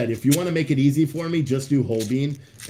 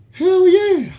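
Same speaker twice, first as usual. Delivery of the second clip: much higher, louder whoop; hyped cheer; 9 dB louder than usual. Real, not acted. Hypes up stream chat with a loud cheer. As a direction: shout it like a hype man.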